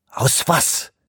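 sharp unvoiced hiss of a question. A narrator's reading. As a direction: spit it out as a hiss. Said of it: "zischte Shoemaker".

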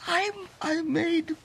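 high, slow, horrified admission; horrified at your own deeds; distraught confession. Acted. Distraught confession of nightmare's scariest part.

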